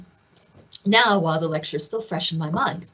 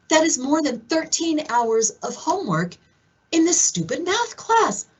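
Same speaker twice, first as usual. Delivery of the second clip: rising exasperation, higher; retold fury; 7 semitones higher than usual. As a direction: build outrage as the sums pile up. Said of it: "I was furious".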